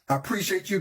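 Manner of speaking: ordinary talk, nothing marked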